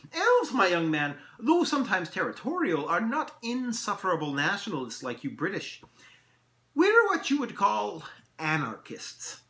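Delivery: high, loud elf voice, slowing; grandly lilting elf holding forth; mirthful elf. Acted. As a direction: explain with lofty amusement. Elf explains his people.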